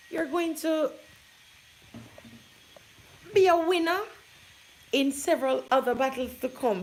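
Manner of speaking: loud, high rallying cry; rousing. Real, not acted. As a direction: fire up the audience. Motivational climax.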